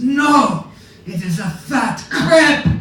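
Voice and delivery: high, loud indignant cry, slowed on the crepe; mock-outraged captain refusing a pancake; high loud scandalised protest; indignant outburst. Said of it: "He says".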